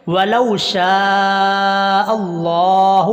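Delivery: slow melodic chant; sung recitation, long drawn vowels; Quranic recitation. Shown as a demonstration. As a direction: sing out the verse like a qari. Teacher recites Quran verse.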